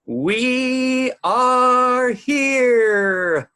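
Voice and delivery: huge, high, long-drawn boom; thundering voice. A narrator's reading. A voice reverberating through the great hall, thundering. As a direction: stretch every word into a thunderous call. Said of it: "a thundering voice reverberated".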